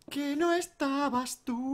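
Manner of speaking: high, slow mocking echo. Gamer mocking a friend's excuse in a high voice. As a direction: parrot him back in a silly high voice.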